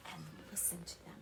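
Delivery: whispered, hushed. Acted. Telling the listener to keep still, whispered. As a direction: breathe it out to invite stillness.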